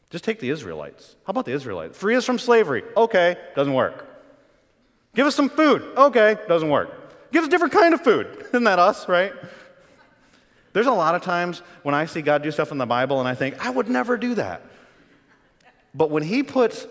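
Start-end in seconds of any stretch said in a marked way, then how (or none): 2.0-2.8 higher, louder mock plea; demanding Israelites. 5.1-5.8 high, loud mock demand; whiny-crowd plea, pitched way up; demanding Israelites. 7.3-8.3 high, loud mock complaint; pitched-up, exasperated crowd plea; demanding Israelites. 13.6-14.6 higher-pitched inner protest; mock disapproval. Preacher voices his own thought.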